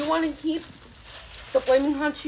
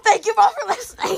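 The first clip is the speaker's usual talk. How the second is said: laughing; 7 semitones higher, 8 dB louder than usual. Real, not acted. Loud laughing sign-off.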